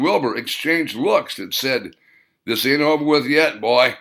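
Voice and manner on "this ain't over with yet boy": menacing. Acted. Silent threat between men.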